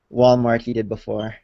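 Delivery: ordinary delivery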